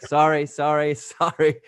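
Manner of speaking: repeated placating sorry, half laughing; apologizing. Retold or imitated. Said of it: "So as I'm apologizing".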